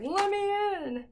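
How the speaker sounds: high, drawn-out plea; comic high pet voice; dog's voice. Acted. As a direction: voice the dog begging, high and slow. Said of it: "he's like".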